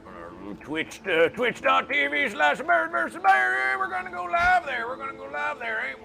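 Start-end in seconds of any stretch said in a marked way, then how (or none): 1.1-6.0 mock announcer villain. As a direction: announce the channel grandly.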